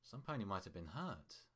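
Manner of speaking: ordinary delivery, nothing marked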